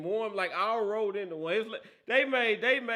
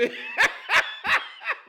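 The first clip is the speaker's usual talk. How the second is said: loud, high-pitched burst of laughter; high cackling laugh; 7 dB louder than usual. Real, not acted. Host laughing at Cowboys fans, loud high cackle. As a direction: howl with laughter.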